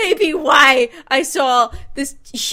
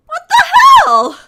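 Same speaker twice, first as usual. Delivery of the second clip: high, loud what the hell; sudden outraged shout; startled yell; 12 dB louder than usual. Real, not acted. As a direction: yell it at the screen. Streamer yelling at game character.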